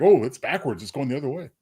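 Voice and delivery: ordinary delivery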